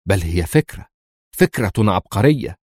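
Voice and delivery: ordinary delivery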